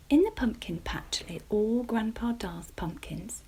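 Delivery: ordinary delivery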